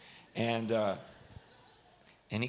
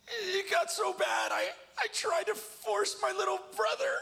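very high, strained tearful cry; choked-up confession; 3 dB louder than usual. Retold or imitated. Bawling boy confessing to whole camp, can barely talk. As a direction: imitate a sobbing boy shouting out his worst confession. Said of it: "couldn't even talk anymore".

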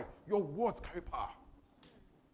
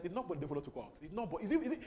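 ordinary delivery

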